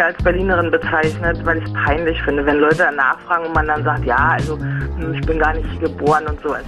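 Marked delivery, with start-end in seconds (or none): none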